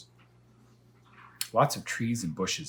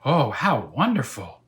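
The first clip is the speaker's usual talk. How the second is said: loud, drawn-out higher gasp of delight; 5 semitones higher, 10 dB louder than usual. Acted. Elmer thrilled by the dragon, loud slow delight. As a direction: sigh out the wonder loudly. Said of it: "said my father".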